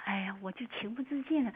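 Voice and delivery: breathy, choked-up whisper on the verge of tears; barely voiced, holding back tears; tearful whisper. Real, not acted. Caller remembering her late mother, whispering through tears. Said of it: "就开始流泪".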